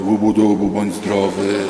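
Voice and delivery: lightly voiced prophet's incantation, low and drawn out at the end; a hint of mock healer's mumbo jumbo, stretched out; imagined prophet. Sick man imagines the prophet healing him, lightly mocked slow incantation. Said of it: "a prorok powie".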